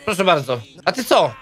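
ordinary delivery

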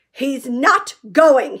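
loud, very slow, flat refusal; stubborn refusal. A narrator's reading. Angry Uncle Vernon refusing to let Harry go. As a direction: plant your feet.

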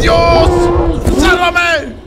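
high, shouted cry for help; frantic high shout; panicked plea. Real, not acted. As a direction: yell out to be saved. Begging for rescue in game.

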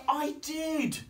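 high, loud protest; shrieked storybook child's insistence; insistent child. Acted. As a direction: insist like a little girl disbelieved. Lulu insists to doubting Daddy.

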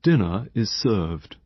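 slow, measured butler's announcement; lightly voiced formal manservant; butler announcing. A narrator's reading. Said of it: "the butler opened the door and announced".

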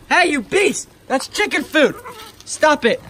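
high-pitched indignant scolding; scolding an animal. Real, not acted. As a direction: tell off a greedy animal. Scolding animal stealing chicken food.

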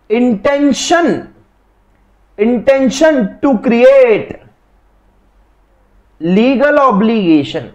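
each word drawn out slowly, as if for notes; slow emphatic definition. Shown as a demonstration. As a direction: spell out "intention to create legal obligation" word by word, slowly. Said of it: "आपका intention होना चाहिए". (धीरे-धीरे ज़ोर देकर परिभाषा बोलना)

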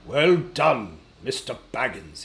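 lightly voiced old man, hearty praise; a hint of an old wizard congratulating. A narrator's reading. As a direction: praise in a slightly altered elder's voice. Gandalf praises Bilbo in story.

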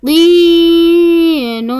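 long held ee in طين; طين said slowly with a sustained ee; elongated letter. Shown as a demonstration. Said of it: "حرف الطاء ممدود بالياء".

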